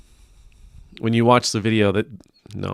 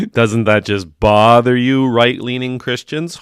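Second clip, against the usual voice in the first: louder, slower, gloating mock taunt; 2 semitones higher, 8 dB louder than usual. Host mockingly baiting right-leaning Christians, loud gloating taunt. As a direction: needle them in a hammed-up troll voice. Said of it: "See what I'm doing there?".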